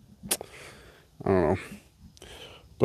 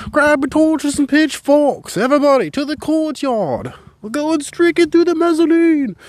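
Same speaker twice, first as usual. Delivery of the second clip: high, loud rabble-rousing shout; angry mob leader; 6 dB louder than usual. Pretend. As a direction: whip the crowd into a frenzy. Mock call to revolt.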